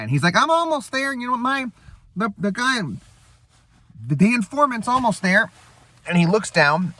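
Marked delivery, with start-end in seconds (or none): 0.4-5.5 high, breezy Owen Wilson impression. Spy reassuring the base over the phone, high airy Owen Wilson voice. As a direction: play the laid-back spy on the phone.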